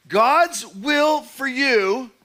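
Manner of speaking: very slow, high, loud, each word drawn out; slow emphasis. Real, not acted. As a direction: draw out God's will for you for weight. Preacher emphasizes the key phrase.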